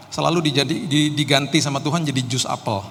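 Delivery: normal talking voice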